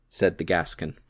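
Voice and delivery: ordinary delivery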